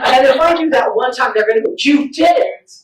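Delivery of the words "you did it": put-on higher, louder kid catching you out; mock-accusing kid voice; accusing child. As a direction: mimic a child's gotcha cry. Acting kids catching her peeking.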